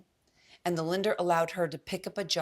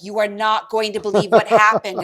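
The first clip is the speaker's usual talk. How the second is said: much louder, dramatic warning; lender's exclamation; 9 dB louder than usual. Retold or imitated. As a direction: announce bad news loudly. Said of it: "the lender's like".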